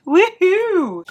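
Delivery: high whooping cheer; cheerful whoop. Real, not acted. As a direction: celebrate with a little whoop. Celebrating books read.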